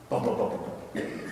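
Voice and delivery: low, soft booms dying away; imitated distant footsteps. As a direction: let the footsteps patter off quietly. Replays footsteps going off.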